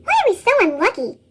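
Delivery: high, distressed storybook outburst; blurted out. A narrator's reading. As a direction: blurt it out to Dad, pitch jumping up. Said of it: "he blurted out".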